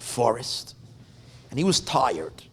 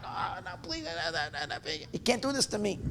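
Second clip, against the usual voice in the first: panicked pleading; 7 dB quieter, faster than usual. Retold or imitated. Sleeping merchant begs the axeman for mercy, panicked pleading. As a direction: plead in a small, frantic voice. Victim begs attacker with axe.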